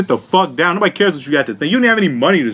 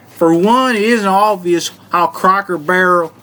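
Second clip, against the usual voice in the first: put-on Southern accent. Host reads the article's first reason, put-on Southern accent. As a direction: read it out like a Southerner, with twang. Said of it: "read this whole article in this voice".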